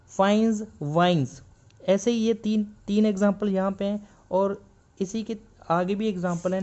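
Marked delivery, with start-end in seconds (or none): 0.1-1.4 फाइन्स, वाइन्स ending in z; words with final z; z sound at end. Shown as a demonstration. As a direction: buzz the final z in both. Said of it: "जेड की sound देगा".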